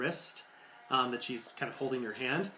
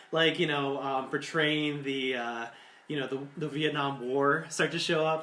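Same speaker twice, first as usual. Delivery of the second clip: usual speaking voice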